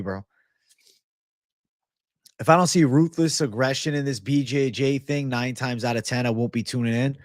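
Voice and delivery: ordinary talk, nothing marked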